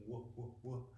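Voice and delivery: "woo" said with a round w, softly repeated; soft repeated "woo, woo, woo" to show the old W; w sound demo. Shown as a demonstration. Teacher showing students the W sound, softly. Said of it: "like a W".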